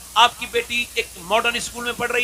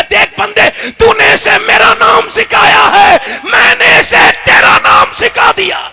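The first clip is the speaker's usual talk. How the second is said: slow, high, shouted proclamation; booming divine voice; 11 semitones higher, 11 dB louder, slower than usual. Acted. God telling the parent he taught the child His name, booming proclamation. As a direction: thunder it down like God speaking to man.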